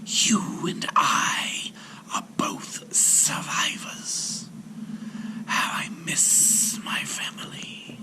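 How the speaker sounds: slow, grieving whispered serpent; a lonely old snake breathing out its loss; sorrowful serpent whisper. A narrator's reading. Serpent tells the sailor of loss, whispering sadly. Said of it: "answered the serpent".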